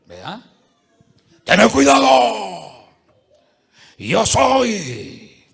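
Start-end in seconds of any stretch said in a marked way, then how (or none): 1.4-5.4 slow, much louder mock-threatening shout; menacing shout. Preacher voices the caller threatening the victim, loud. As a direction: bellow a warning like a demon. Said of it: "Yo soy el príncipe Luzbel".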